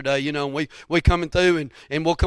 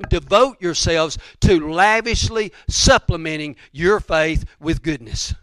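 slow, weighty preacher's recitation; deliberate, forceful exhortation; emphatic scripture quote; 2 semitones higher, 7 dB louder, slower than usual. Acted. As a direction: punch out the verse phrase by phrase. Preacher declaims the verse.

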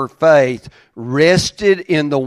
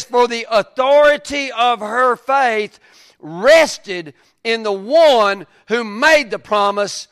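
slow, higher-pitched preaching delivery; emphatic scripture reading; 6 semitones higher, slower than usual. Acted. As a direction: proclaim the verse with weight.

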